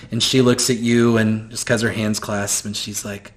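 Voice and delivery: usual speaking voice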